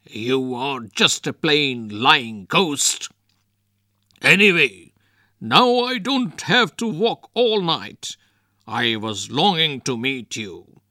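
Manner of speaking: bold declaration. A narrator's reading. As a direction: tell the ghost off without fear.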